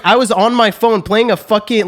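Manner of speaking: ordinary delivery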